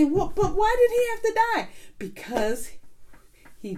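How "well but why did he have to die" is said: high, quick puzzled question; higher, faster imitated questioner; questioning young listener. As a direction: voice a listener's objection, pitched well up and hurried. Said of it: "And you say".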